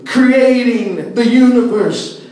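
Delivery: normal talking voice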